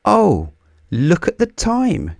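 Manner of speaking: suddenly notice the time, high and alarmed; crying out. A narrator's reading. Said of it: "cried Little Miss Whoops".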